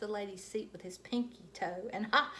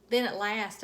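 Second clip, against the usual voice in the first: plain reading voice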